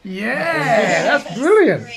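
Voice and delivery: loud laughing praise. Real, not acted. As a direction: laugh through delighted words. Delighted at app result.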